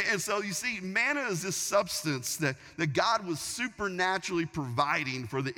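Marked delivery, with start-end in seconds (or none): none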